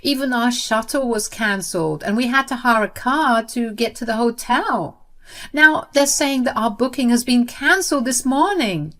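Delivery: high, loud, frantic and close to tears; panicked. Acted. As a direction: panic as if everything is falling apart. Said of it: "called me in a panic and on the verge of tears".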